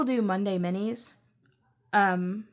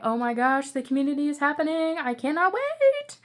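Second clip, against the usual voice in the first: gush, climbing higher into a stretched squeal of anticipation; giddy excitement; 5 semitones higher, 3 dB louder than usual. Retold or imitated. Creator thrilled that people are commenting, giddy and climbing high. Voicing excitement at growing community.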